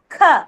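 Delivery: K pushed out with breath; stressed K. Shown as a demonstration. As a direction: model K firmly, with an h puff. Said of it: "You have to stress and pronounce".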